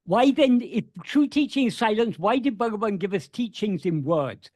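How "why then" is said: ordinary talk, nothing marked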